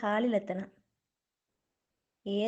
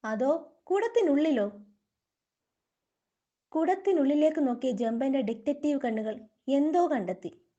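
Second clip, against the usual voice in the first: usual speaking voice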